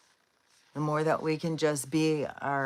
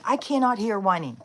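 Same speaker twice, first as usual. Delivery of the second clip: louder, higher fed-up outburst; retold exasperation with raised voice; driven crazy; 6 semitones higher, 8 dB louder than usual. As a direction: snap at whining kids, exasperated. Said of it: "whining drives me crazy".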